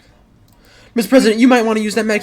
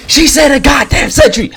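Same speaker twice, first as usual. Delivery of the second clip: angry shout; 7 semitones higher, 10 dB louder, slower than usual. Real, not acted. Outraged callout in the game.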